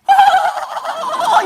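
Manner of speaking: put-on high, slow, drawn-out baby laugh; imitated baby giggle. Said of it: "이러고 웃는 거예요". (아기가 웃는 소리를 높고 길게 흉내)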